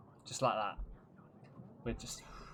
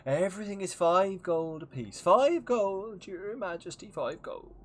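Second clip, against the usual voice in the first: put-on higher, louder, slow merchant with an odd regional accent; merchant with weird accent; 6 semitones higher, 6 dB louder, slower than usual. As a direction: sell your exotic wares like a showy peddler. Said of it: "he's got a weird accent".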